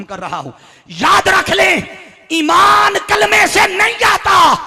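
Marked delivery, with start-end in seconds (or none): 0.9-1.8 high, loud preacher's shout; commanding shout. Real, not acted. Preacher warning the congregation to remember, shouting. 2.3-4.5 high, slow, very loud shout; thundering declaration. Real, not acted. Preacher declaring his main point to the congregation, thundering.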